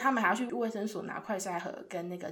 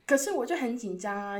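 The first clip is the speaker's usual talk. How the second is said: normal talking voice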